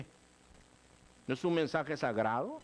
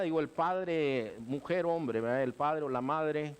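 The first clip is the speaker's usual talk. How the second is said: normal talking voice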